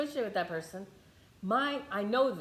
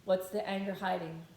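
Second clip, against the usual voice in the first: ordinary talk, nothing marked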